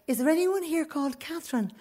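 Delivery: high, soft searching query; mother calling. Retold or imitated. Mother searching the ladies' toilet, politely calling. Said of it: "And then I went".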